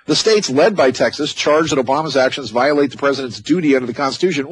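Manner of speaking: ordinary talk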